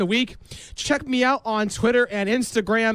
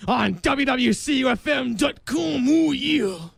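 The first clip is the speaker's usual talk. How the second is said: wrestler impression; 3 semitones higher than usual. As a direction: bellow it like a wrestler cutting a promo. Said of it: "Let's get out of my impressions".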